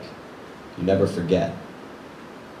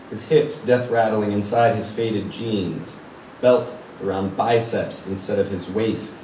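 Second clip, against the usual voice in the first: ordinary delivery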